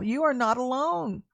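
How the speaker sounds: ordinary delivery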